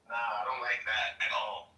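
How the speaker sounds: ordinary delivery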